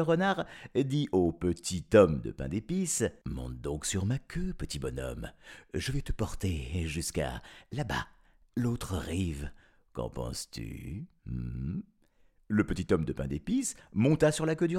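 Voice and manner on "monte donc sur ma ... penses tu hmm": low, soft crafty fox voice; softly scheming fox; sly fox. Acted.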